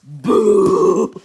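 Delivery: loud, sudden scare shout; shouted boo. A narrator's reading. Narrator scares the listener with a loud boo. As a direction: jump out and yell it. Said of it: "and shout".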